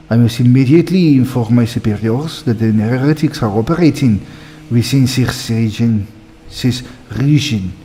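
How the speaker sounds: put-on heavily French-accented game character. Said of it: "These people are super French".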